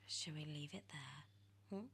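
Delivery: usual speaking voice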